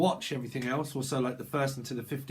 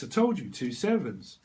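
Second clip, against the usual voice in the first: ordinary talk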